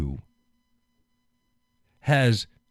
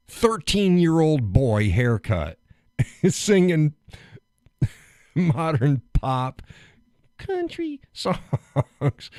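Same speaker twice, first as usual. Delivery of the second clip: mocking drawl; 7 semitones higher, 4 dB louder, much slower than usual. Real, not acted. Mocking [name]'s look.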